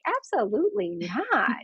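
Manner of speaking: stubborn refusal. Retold or imitated. Patient flatly refusing the doctor's order, slow and defiant. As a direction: dig in your heels and stretch every word.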